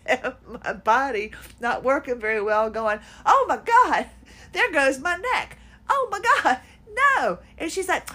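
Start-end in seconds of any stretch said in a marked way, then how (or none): 3.2-7.4 high, loud panicked outburst; panicked cry. Retold or imitated. Jumping off the table, a loud panicked cry. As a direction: panic as the neck goes out.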